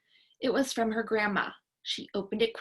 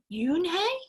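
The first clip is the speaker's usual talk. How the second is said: higher pitch, rising to the end; wide-eyed question; 7 semitones higher than usual. A narrator's reading. Joey asks Yoonhae her name, wide-eyed. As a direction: ask the name in wonder. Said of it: "he asked her with his eyes open wide".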